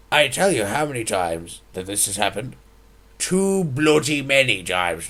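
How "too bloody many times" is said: exasperated emphasis. Acted. Exasperated rant.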